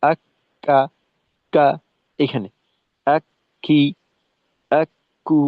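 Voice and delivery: আক্কা আক্কি আক্কু with the k held, then a little air on release; doubled letter demo. Shown as a demonstration. Teacher showing the tashdid with আক্কা. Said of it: "যখন পৃথক হব তখন একটু বাতাস থাকবে".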